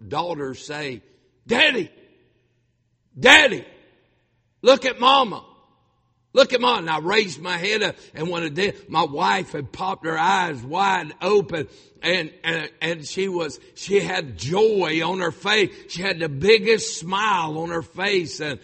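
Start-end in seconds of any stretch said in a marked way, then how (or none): 1.5-6.8 loud, high calling out to Dad; high-pitched, insistent shout; daughter's urgent cry. Retold or imitated. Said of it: "I heard my daughter say". 6.8-18.6 loud, raised-pitch impassioned storytelling; fervent preacher's retelling; excited testimony. Real, not acted. Preacher excitedly retells wife's last moments.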